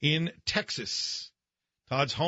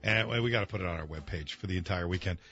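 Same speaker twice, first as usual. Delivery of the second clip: after laughing hard; 8 semitones lower than usual. Real, not acted. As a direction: lower and breathless after laughter. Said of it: "we're dying in here".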